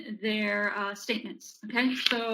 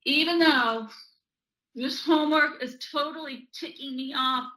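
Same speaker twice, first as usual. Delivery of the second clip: higher, punchy mild mock anger; acted annoyance; 4 semitones higher, 4 dB louder than usual. Demonstrating tapping setup as a child with homework, acted irritation. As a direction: vent like an irritated pupil. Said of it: "what that would look like".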